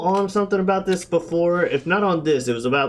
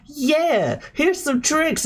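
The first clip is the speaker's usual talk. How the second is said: lightly voiced kids; 9 semitones higher, slower than usual.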